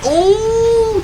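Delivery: high, slow oh of surprise; a shocked drawn-out oh; surprised gasp. Real, not acted. Sudden surprise in fight.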